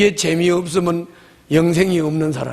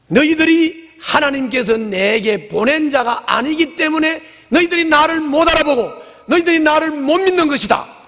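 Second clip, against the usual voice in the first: higher, rising scolding; stern rebuke; 10 semitones higher, 3 dB louder than usual. Acted. Jesus rebuking the crowd, raised and stern. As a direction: scold them for not believing what they see.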